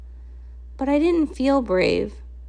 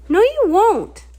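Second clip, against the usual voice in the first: higher, louder defiant cry; defiant shout; 8 semitones higher, 5 dB louder than usual. Acted. Young Cadance defying Prismia, high and loud. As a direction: stand up to her.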